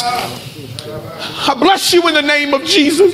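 high, drawn-out preacher's exhortation; fervent preaching. Real, not acted. Preacher leading fathers to bless their sons, fervent preaching. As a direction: charge the congregation with slow, fervent blessing.

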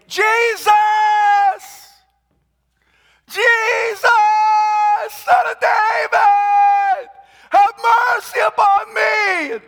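desperate high shout; crying out. Retold or imitated. Blind beggar shouting to Jesus from roadside, desperate cry. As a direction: yell for mercy at the top of your lungs. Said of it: "he began to cry out".

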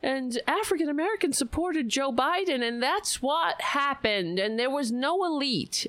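high, sing-song pundit parody; mocking liberal media. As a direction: parrot the official story sarcastically. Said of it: "the so-called liberal media, talking about".

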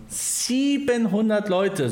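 high, slow, amazed "Siebenhundert"; amazed emphasis. Real, not acted. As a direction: stretch "Siebenhundert" out in disbelief. Repeats the number in amazement.

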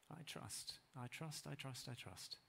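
soft repeated whisper of trust; hushed trust. Acted. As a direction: repeat it quietly, tenderly.

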